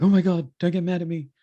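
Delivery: high, pleading, fast whine; fast pleading dog voice; voicing the needy dog. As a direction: grovel like a frightened puppy. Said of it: "be like".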